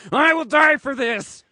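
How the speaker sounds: loud, high-pitched outburst; fervent vow. A narrator's reading. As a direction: declare it like a madman ready to die. Mad bear vows to die.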